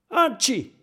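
high yowl of pain; howling ouch. Acted. Oskar howling in pain after the fall. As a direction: howl as you hit bottom. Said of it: "jault Oskar".

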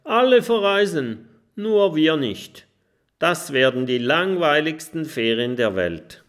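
put-on high, loud voice of a sulking young dragon; sulky little dragon. A narrator's reading. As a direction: complain like a bored kid on holiday. Kokosnuss voiced in the story. (hoher, lauter Ton eines schmollenden kleinen Drachen)

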